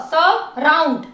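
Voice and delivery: Surround slowly, as स and round; slow, segmented surround; syllable by syllable. Shown as a demonstration. Teacher splits word into syllables.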